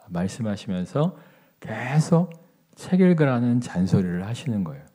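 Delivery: ordinary talk, nothing marked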